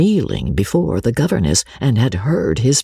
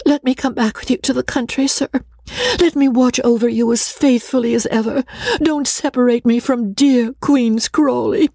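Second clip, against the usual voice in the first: put-on high-pitched imploring young governess; beseeching, high and urgent; pleading governess; 12 semitones higher than usual.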